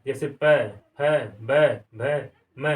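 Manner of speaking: पै फै बै भै मै with lips pressed together; letter demo. Shown as a demonstration. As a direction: make the lip closure clear on each. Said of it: "हमारे ओंठ जो है एक दूसरे से छूते हैं".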